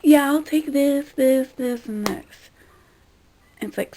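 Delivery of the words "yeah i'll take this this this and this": higher, louder mock customer ordering; lightly hammed-up diner interrupting with an order; imitating hurried customer. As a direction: put on an eager customer rattling off picks. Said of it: "some people would be like".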